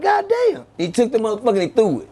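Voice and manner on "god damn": much higher, yelled disbelief; shocked shout. Retold or imitated. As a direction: shout it like you can't believe your eyes. Watching him take gun apart.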